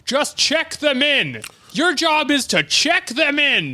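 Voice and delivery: lightly voiced grumpy old doctor waving her off; old doctor snapping back at his receptionist, mock impatient. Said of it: "And he's like".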